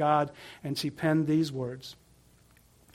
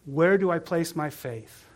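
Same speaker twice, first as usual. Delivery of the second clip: normal talking voice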